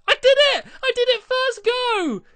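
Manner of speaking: high, loud cry of victory; triumphant shout. Real, not acted. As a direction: yell it like you just won. Hits target on first try.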